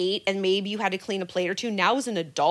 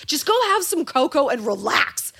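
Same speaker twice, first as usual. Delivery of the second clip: higher, sweetly dismissive; passive-aggressive; 5 semitones higher, 5 dB louder than usual. Retold or imitated. Quoting the sign's dismissive advice, mock sweet. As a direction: brush them off sweetly. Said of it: "passive-aggressive".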